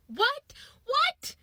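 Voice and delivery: high-pitched incredulous squawk. Retold or imitated. Comedian reacting to friend's lie, incredulous. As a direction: shoot up high in outraged disbelief.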